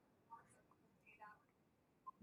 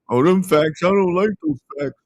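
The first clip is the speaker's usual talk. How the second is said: lightly voiced whiny complainer; mocking a whiny critic; 3 semitones higher, 3 dB louder than usual. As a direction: poke fun at people who hate facts. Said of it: "don't get mad at me when I speak facts".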